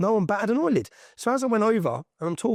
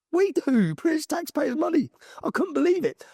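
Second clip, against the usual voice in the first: indignant disbelief; 11 semitones higher than usual. Real, not acted. Outraged at taxpayers funding.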